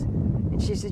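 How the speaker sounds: usual speaking voice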